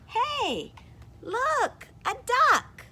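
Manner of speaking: high, loud excited character voice; excited first character. Acted. Teacher reading the duck-seer's line aloud, excited. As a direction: point it out with a thrill. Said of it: "This person says".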